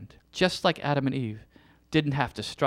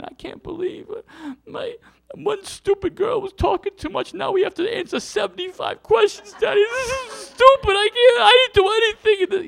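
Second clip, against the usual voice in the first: put-on much higher, loud whining child voice near tears; whiny crying child; 5 dB louder than usual. Father imitating his daughter crying over homework, high whiny wail. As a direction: mimic a kid wailing over homework.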